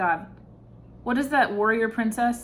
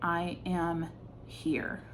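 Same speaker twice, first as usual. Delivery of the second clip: "I am here" said lower, much softer and slow; quiet solemn statement; 4 semitones lower, 8 dB quieter, slower than usual. Shown as a demonstration. Reader showing a champion's quiet certainty, softly. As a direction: state it simply, no need to prove anything. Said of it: "It would just be like".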